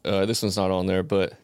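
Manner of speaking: ordinary talk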